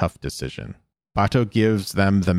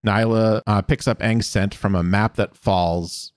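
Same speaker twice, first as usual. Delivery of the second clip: usual speaking voice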